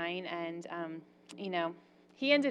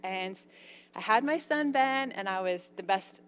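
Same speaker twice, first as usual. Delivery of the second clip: normal talking voice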